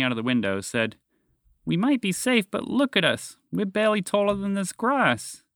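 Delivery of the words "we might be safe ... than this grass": put-on higher pitched young boy, dismayed; high kid voice, worried and stunned; boy's voice James. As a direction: voice the boy up high, alarmed at his tiny size.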